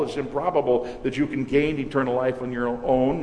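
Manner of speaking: ordinary delivery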